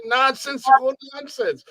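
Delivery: high-pitched fan voice; excited drunk fan. Retold or imitated. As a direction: imitate a fan insisting in a high voice. Stoned fan names the podcast.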